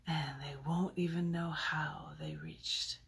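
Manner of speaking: slow, whispered and fading; a poisoner's final hushed threat; devilish whisper. Acted. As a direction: let eternal night die away to a breath. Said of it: "You'd think that I was devilish".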